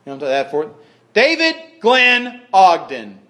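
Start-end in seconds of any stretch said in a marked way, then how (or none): 1.1-3.2 high, loud, slow full-name call; scolding mom. Acted. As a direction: call out each name like a cross mother. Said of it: "your mom calls you by your middle name".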